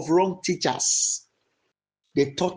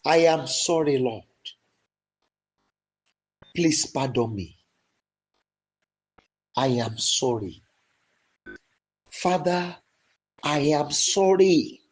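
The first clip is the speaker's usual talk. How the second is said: passionate repentance prayer that swells into shouts; fervent prayer; 4 dB louder than usual. Shown as a demonstration. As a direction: cry out to God for pardon, building and falling. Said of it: "Go ahead and pray".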